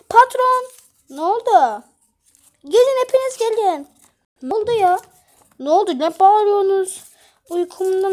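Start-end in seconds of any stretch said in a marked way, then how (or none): 0.1-0.6 alarmed call. Acted. Calling the boss in alarm. 2.6-3.9 calling out. Acted. Summoning everyone in alarm.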